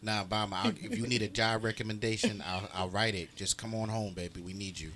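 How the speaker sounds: low, coaxing soul-singer voice; mock-seductive low pleading. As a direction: plead deep and smooth, like a lover.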